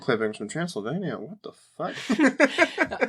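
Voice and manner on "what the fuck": high, very slow, drawn-out disbelief; mock disbelief. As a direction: drag out the words high, in amazed disbelief. Reader reacting to the book.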